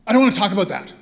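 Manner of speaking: loud, sharp refusal from a cornered patient; angry refusal. Retold or imitated. Dying mother snapping at her caregiver, angry loud refusal.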